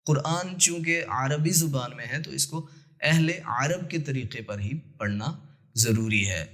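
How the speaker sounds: ordinary talk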